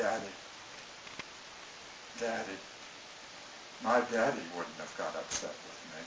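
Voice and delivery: mock tearful child pleading; whimpering daughter. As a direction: plead through little sobs. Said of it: "She says".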